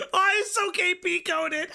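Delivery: high laughing squeal. Real, not acted. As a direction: laugh through the words. Streamer bursts into laughter.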